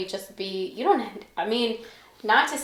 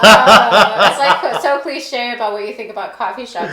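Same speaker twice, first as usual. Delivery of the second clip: laughing; 15 dB louder than usual. Real, not acted. Laughing about coffee shop cliche.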